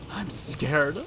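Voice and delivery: hushed scared admission. Acted. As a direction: admit fear under your breath. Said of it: "Yoshimura shudders as he looks downward".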